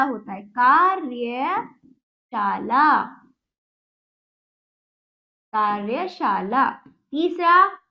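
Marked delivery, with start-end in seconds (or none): 0.4-6.7 कार्यशाला slowly as "kaar-ya... shaa-laa"; slow syllables. Shown as a demonstration. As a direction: sound out कार्यशाला part by part, r before y. Teacher sounds out the correct word.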